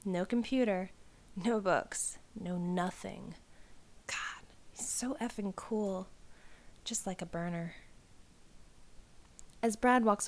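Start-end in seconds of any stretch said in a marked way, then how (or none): 4.1-6.1 breathy whispered awe, slow; whispered thought. Acted. Girl whispers to herself about the cool boy, in a slow whisper. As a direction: whisper it like a secret crush. Swooning over Brad in hallway.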